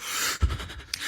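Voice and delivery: breathy horrified gasp; mock shocked gasp. Imitating other queens gasping in shock. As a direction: gasp like a rival queen caught out. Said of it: "And they're like".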